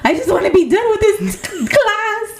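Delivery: high, laughing near-wail of frustration; hammed-up high-pitched despair; exasperated whine. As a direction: whine it out, dragging and cracking into laughter. Hammed-up whine about her dreaded class.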